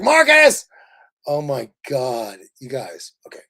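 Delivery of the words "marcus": loud high call across the street; excited shout of a friend's name; yelled name. Retold or imitated. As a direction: shout his name out the car window. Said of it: "Ricky Lawrence is like".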